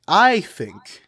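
"I" hit high and loud in "I think"; stress on I. Shown as a demonstration. Co-host stressing "I" to mark it as opinion, emphatic. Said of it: "I'm just emphasizing the word I think".

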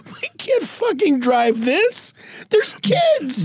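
high, drawn-out mock protest; appalled Batman.

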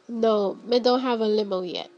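normal talking voice

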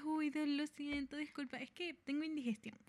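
soft, embarrassed apology; mock sheepish apology. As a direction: mumble it, ashamed. Imagined embarrassed apology after burping.

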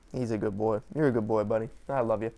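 low, soft and tender, cooing at the dog; tender pet talk. Real, not acted. Owner softly praising his dog, low and tender.

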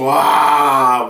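loud imitated "Wah" of excited pupils; mock kids' wow. Preacher imitating middle schoolers reacting to a tiger picture, loud wow. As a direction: burst out like kids seeing a tiger.

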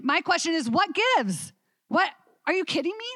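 incredulous teacher venting; exasperated disbelief. Real, not acted. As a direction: throw up your hands in disbelief. Exasperated rhetorical outburst.

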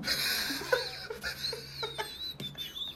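high strained laugh-cry under weight; strained laughing groan. Retold or imitated. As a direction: squeal with effort, laughing. Straining under heavy barbell.

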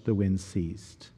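hushed. Acted. Hushed after storm calms.

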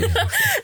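short high giggle; quick real laugh, pitched high. Real, not acted. Laughs after Birmingham joke.

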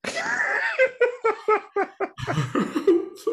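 squealing high laugh. Real, not acted. Laughing at Voldemort joke.

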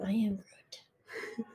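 whispered, drawn-out catchphrase; very slow, hushed character voice; Groot. Acted. Friend does the catchphrase the other refused, slow whispered Groot.

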